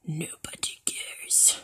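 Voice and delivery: slow, breath-only whisper; very soft, drawn-out whisper; whispered dismissal. Real, not acted. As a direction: whisper it slowly, stretching the words out. Whispered repeat of loud line.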